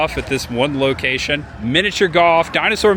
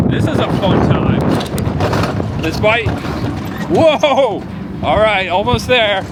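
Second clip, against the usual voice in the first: excited high voice; 7 semitones higher, slower than usual. Real, not acted. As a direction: call out joyfully on the ride. Coaster spiral down.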